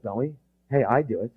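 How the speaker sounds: ordinary talk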